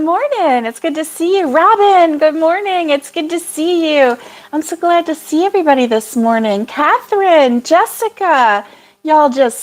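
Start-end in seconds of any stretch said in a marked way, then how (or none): none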